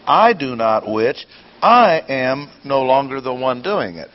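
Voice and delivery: both I's punched at the start of each phrase; emphatic opening I each time; stress on I, twice. Shown as a demonstration. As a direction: hit each I hard, then ease off.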